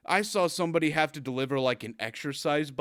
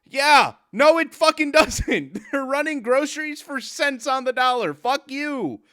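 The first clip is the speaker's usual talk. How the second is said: loud, higher, heated retort; angry rant; 12 semitones higher, 8 dB louder than usual. Acted. Shouting down a customer's excuse, loud and angry. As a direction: shout them down.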